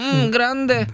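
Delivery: high, savoring mock bedroom moan; playful high-pitched sultry Spanish; pleased moan. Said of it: "om du hör grande, då blir du glad".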